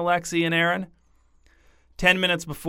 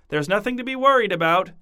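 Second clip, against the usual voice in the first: terrified reassurance; 7 semitones higher than usual. Acted. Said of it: "She looked terrified".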